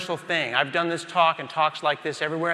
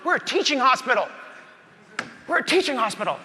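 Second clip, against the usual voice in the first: insistent doctor; 8 semitones higher than usual. Acted. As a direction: push it like an excited senior doctor. Resident insists on teaching.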